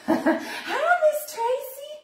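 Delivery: high, drawn-out hello through a laugh; laughing greeting. Real, not acted. Crafter greeting a viewer mid-video, laughing and high-pitched.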